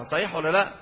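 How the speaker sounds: usual speaking voice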